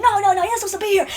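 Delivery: high-pitched mock objection; imitated alarmed guard. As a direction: protest as the startled guard. Guards refuse his explanation.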